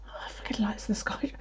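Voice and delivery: soft scornful whisper aside; sarcastic whisper. Retold or imitated. Mocking Alex's alien story under her breath, whispered. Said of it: "unvoiced whisper".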